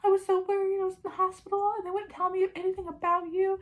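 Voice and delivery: high, put-on tearful voice; fake crying. Marcella fakes tears for her husband, high and mock tearful. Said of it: "she just starts crying".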